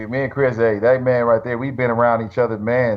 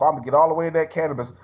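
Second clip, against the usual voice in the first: usual speaking voice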